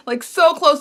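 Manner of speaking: normal talking voice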